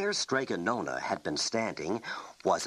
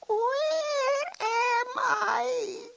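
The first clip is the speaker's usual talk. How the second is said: dazed surprise; 4 dB louder, much slower than usual. Acted. Witch returns dazed.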